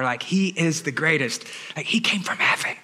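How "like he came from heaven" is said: whispered aside; stage-whispered answer. Real, not acted. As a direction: drop to a whisper as if sharing a secret. Aside answering obvious question.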